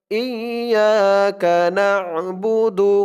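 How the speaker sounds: slow tajweed chant, vowels held long; Quran recitation. Shown as a demonstration. Teacher modelling the verse for learners, slow Quran recitation. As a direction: recite it slowly and measured, syllables drawn out.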